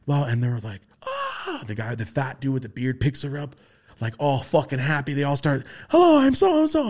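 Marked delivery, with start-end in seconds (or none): 1.0-1.6 very high soft aah; delighted aah. Retold or imitated. The beings see Rosie, high delighted aah. 5.9-6.9 much higher, louder cheerful hello; eager hellos. Retold or imitated. The beings greet Rosie, high eager hello.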